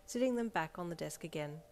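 plain reading voice

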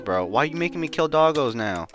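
ordinary talk

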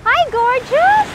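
high, slow, stretched-out hello; drawn-out doting hi to her daughter; sing-song greeting. Real, not acted. Mom greeting daughter on beach.

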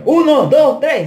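loud, high-pitched count; retold countdown shout. Sergeant starting the fight in the room, shouted count. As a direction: call the start of a bout.

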